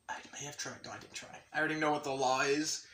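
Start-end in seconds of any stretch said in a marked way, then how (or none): 0.1-1.3 soft, guilty whisper; sheepish whisper; whispered aside. Real, not acted. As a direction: slip it in under your breath.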